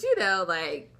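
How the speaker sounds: usual speaking voice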